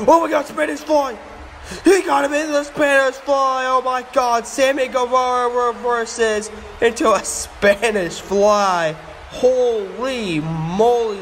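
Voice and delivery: high, breakneck wrestling call; amazed high-pitched commentary; excited play-by-play. Pretend. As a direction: go wild over a spectacular move. Commentator reacts to big move.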